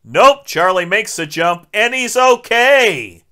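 loud, high burst of relief; exaggerated happy reveal; mock triumph. Comic reversal of fake ending.

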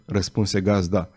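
ordinary delivery

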